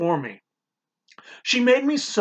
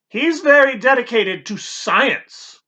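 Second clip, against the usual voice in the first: "science" said with slow reverence; slow, louder, revering "science"; reverent emphasis; 7 semitones higher, 5 dB louder, slower than usual. A narrator's reading. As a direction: housekeeper praising the doctor's dedication to science. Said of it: "emphasizing the word with reverence".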